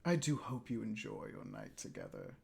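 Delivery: low, soft, slow sinister purr; possessed boy coolly threatening his date, low and soft; sadistic. Acted. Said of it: "a sadistic smile comes across Kyle's face".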